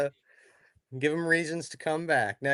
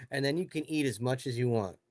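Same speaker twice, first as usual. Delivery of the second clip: ordinary talk, nothing marked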